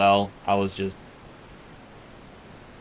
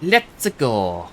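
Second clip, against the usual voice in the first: Mario impression; 3 semitones higher, 5 dB quieter, much slower than usual. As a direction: ham up the plumber's cry. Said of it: "replacement to Charles Martinet".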